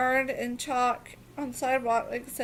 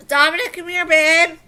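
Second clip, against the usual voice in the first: calling out; 5 semitones higher, 11 dB louder than usual. Real, not acted. Calling to her son.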